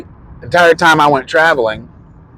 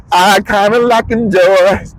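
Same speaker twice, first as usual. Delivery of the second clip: slow, stretched silly repeat; drawn-out mock; 7 semitones higher, slower than usual. Shown as a demonstration. Vlogger mocking his own filler words, slowly. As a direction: drag the phrase out lazily. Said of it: "I don't know what the hell I'm saying".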